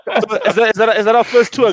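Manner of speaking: high, laughing, excited; gleeful high-pitched delight; thrilled by fans abroad, high and laughing; excited squeal. Real, not acted. Excited about fans from Indonesia.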